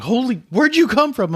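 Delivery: sudden high startled shout; startled yelp. Retold or imitated. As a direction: jump out of your skin. Startled people voiced.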